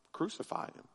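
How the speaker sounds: breathy whispered Crucify him, no voice; hushed crowd cry. A narrator's reading. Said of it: "And in a loud voice, the crowd cried out".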